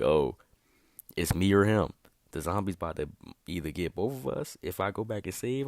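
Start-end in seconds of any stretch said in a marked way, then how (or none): 0.0-1.9 desperate cry. Pretend.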